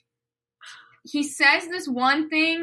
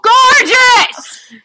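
high, very loud gushing shout; yelling; 11 semitones higher, 14 dB louder than usual. Real, not acted. Enthusing about the Dracula castle, comic full yell. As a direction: yell it over the others. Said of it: "Please continue yelling about the Dracula castle".

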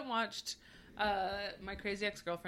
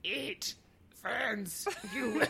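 Yoda voice; much slower than usual. Shown as a demonstration. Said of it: "Why is Yoda here".

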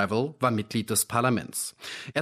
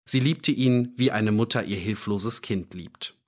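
ordinary delivery, nothing marked

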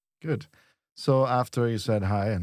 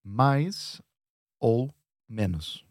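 "mais", "ou", "menos" with clear pauses; word-by-word "mais ... ou ... menos"; three separate words; much slower than usual. Shown as a demonstration. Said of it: "there are three words here".

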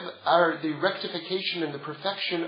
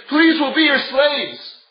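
slow, loud, higher begging plea; pleading brothers; 6 semitones higher, 7 dB louder, slower than usual. Acted. Brothers throwing themselves down at Yosef's feet, slow pleading cry. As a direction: drag it out like desperate brothers begging.

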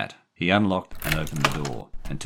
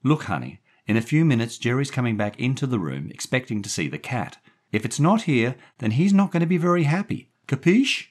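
high voice climbing higher and louder; warning woman; 10 semitones higher, 5 dB louder than usual. A narrator's reading. Daphne warning the boy of Jerry's return, warning woman. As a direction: build to a pointed warning.

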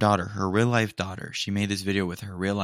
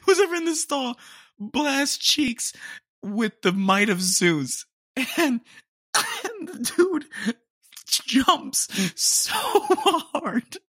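high, slowed words stretched by laughter; squeezing out the punchline between laughs; laughing hard; slower than usual. Real, not acted. Telling viewers about the stall blast, helpless laughter. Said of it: "I'm dying".

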